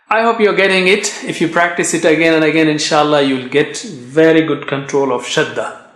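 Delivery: ordinary delivery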